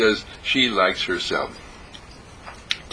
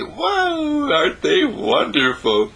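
"Wow" leaps very high, then slow wonder; delighted wow; 9 semitones higher, slower than usual. Retold or imitated. Admiring others after sharing a smile, delighted. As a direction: gasp out wow, then savour the words.

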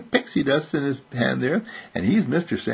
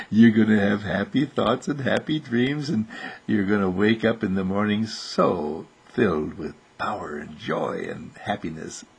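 low, fading lullaby-like speech; soothing bedtime wish; 3 semitones lower than usual. Real, not acted. Tucking the child in to sleep.

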